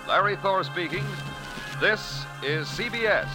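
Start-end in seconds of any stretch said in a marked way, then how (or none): none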